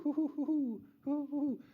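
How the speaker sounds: high repeated owl hooting. Retold or imitated. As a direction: keep hooting like a barred owl. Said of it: "That's a barred owl".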